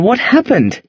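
plain reading voice

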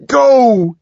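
loud, high furious order; explosive angry command; menacing shout. A narrator's reading. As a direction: bellow it standing over someone. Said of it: "he shouted".

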